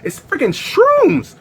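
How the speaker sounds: high, slow indignant yell; outraged. Retold or imitated. Guy yelling at friend about the shrooms, high indignant yell. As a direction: stretch out the disbelief.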